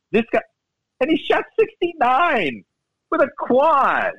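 high, slow, drawn-out astonishment; high and slow like you can't believe the score; amazed disbelief. Real, not acted. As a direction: stretch it out in disbelief. Marvelling at a pro's score.

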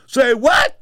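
high, loud mock disbelief; retold disbelief. Ken Norton stunned when offered a cab, high and loud. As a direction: throw out a stunned "say what". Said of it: "He couldn't believe it".

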